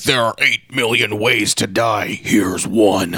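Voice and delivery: slow, ominous announcement imitation; intercom voice. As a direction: intone it slowly like a threat over an intercom. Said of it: "hear a voice on the intercom saying".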